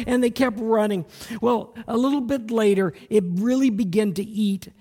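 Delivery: loud, high-pitched preacher storytelling; big sermon-story delivery; preaching at full force. Acted. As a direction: drive the story home from the pulpit.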